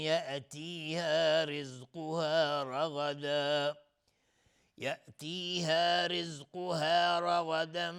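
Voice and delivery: slow, high melodic tajwid chant; Quran recitation. Acted. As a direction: recite the verse slowly and melodically. Recites Quran verse 16:112. (تلاوت آهسته و آهنگین قرآن)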